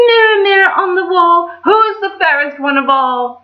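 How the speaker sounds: high, loud queen demanding of her mirror; shrill-free high vain queen voice; wicked queen. Acted. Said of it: "and she said".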